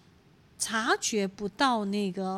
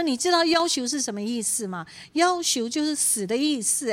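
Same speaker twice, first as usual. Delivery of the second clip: higher, louder retold scolding; imitating angry rebuke; 5 semitones higher, 5 dB louder than usual. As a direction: replay the sister's sharp rebuke. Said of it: "她就很生气说". (模仿姐妹生气地责备)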